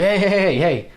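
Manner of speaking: urgent protest. Retold or imitated. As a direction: cut them off before they broadcast it. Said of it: "I was like".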